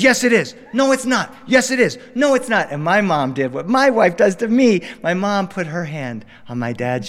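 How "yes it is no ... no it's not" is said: loud, high back-and-forth yelling; playful father-son shouting match; mock shouting match. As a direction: shout it like a stubborn kid arguing.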